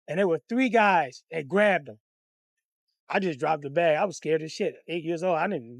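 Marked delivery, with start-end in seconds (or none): none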